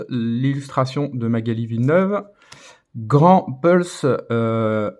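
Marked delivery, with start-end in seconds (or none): 3.0-4.9 slow English card names in a French accent. Real, not acted. Apologetic collector reading English card names to viewers, French-accented English. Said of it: "vous m'excuserez pour mon accent anglais".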